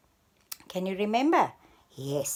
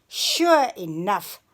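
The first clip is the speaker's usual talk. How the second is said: ordinary delivery, nothing marked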